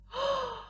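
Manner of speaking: quick high gasp of joy. A narrator's reading. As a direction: catch the breath at the win. Penguin race won.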